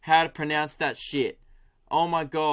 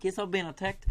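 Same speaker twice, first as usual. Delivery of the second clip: higher, softer imitation of a Kiwi caller; put-on New Zealand caller voice; New Zealand accent; 4 semitones higher, 6 dB quieter than usual. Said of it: "I've got it now".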